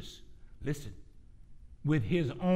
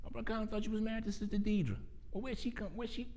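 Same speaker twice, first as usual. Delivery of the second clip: quiet, higher-pitched mock questioning; hushed, hurried confusion, playing a baffled congregant; puzzled church member; 10 semitones higher, 4 dB quieter, faster than usual. Acts shocked member questioning his three wives.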